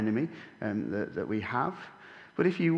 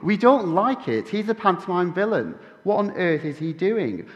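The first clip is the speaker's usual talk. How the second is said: loud, raised panto jeering; jeering crowd; 8 semitones higher, 8 dB louder than usual. Pretend. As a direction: heckle the villain from the stalls. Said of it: "we would cry".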